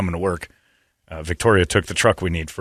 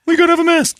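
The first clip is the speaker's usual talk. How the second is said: much higher, louder put-on clerk voice; store clerk voice; 4 dB louder, faster than usual. Store clerk insisting on a mask, in a high put-on voice. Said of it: "a guy goes".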